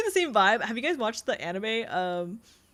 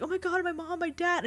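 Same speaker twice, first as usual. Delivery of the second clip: high, softly wailed mock distress; mock crying; 7 semitones higher, 3 dB quieter than usual. Crying girl laments her family to the villain, mock crying. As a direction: imitate a crying girl, pitched up. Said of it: "she's, like, crying".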